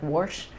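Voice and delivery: "wash" said as "worsh". Shown as a demonstration. Giving cohost another example, Southern pronunciation. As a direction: slip an R into "wash". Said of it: "another example would be".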